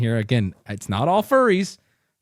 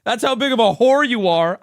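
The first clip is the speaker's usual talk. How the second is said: hyped echo; 8 semitones higher, 6 dB louder than usual. Real, not acted. Echoing the roast line loudly.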